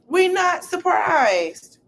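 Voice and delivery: sarcastic drawn-out high "We're not surprised"; high, slow mock voice. Shown as a demonstration.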